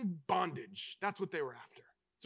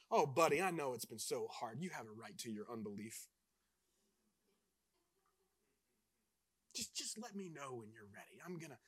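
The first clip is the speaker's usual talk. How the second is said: softer and lower, a pampering tender angel; lightly voiced soothing angel, deliberately wrong; mock coddling angel; 7 semitones lower, 7 dB quieter than usual. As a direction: coo it like an angel babying him. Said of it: "no, no. That's not what".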